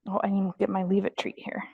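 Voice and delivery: ordinary delivery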